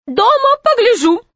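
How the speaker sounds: high proud lady's voice; lofty high lady voice; haughty lady. Acted. Proud tourist lady speaks.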